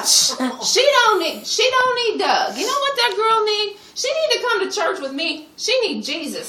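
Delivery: put-on telling everyone what that girl really needs; preachy insistence. Mother preaching about daughter.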